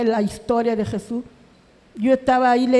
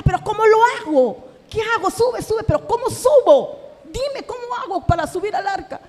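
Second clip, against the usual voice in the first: higher-pitched, pleading cry of not knowing how; retold desperate outcry; 7 semitones higher than usual. Woman retelling her outburst at being told to board the ark, desperate cry. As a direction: recreate crying out in frustration, pitched up. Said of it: "no sé si le grité".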